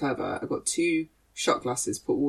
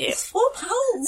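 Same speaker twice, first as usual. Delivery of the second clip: much higher, slow squeaky plea; mock pleading squeak; slower than usual. Begging listeners for Patreon money in a high squeak. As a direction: squeak it out like a beggar.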